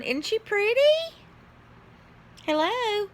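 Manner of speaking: very high cutesy voice; squeaky-high playful show-off; cutesy cooing. Pretend. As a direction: coo it high, showing off something precious. Cooing over an Amazon purchase.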